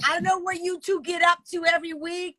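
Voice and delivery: high scolding mom voice, dragged out. Acted. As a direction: scold them like a stern mother breaking up a fight. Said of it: "My mom voice".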